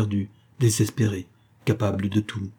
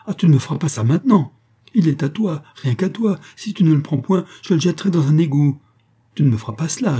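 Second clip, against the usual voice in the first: high, louder outraged protest; indignant revolt; 6 semitones higher, 4 dB louder than usual. A narrator's reading. Mistress revolting as her lover refuses the money, indignant. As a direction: burst out, pitch raised, refusing to accept it. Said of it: "Alors elle se révolta".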